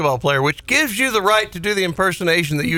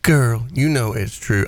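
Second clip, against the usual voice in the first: usual speaking voice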